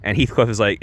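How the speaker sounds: usual speaking voice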